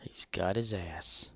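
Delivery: slow whispered aside. Real, not acted. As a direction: whisper it under the clip. Whispered comment over clip.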